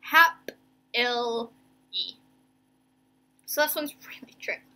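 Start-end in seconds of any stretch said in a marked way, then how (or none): 0.0-1.5 "Hap-il-y" slow, with gaps; syllable by syllable. Shown as a demonstration. Teacher sounding out a tricky word, "Hap-il-y" in separate syllables. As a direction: say "Hap-il-y" as three detached pieces. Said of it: "My first syllable is hap".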